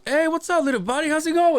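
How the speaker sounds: high sing-song hello to a pet; mock baby-talk to a little bird; imitated bird greeter. Man in video greeting a bird.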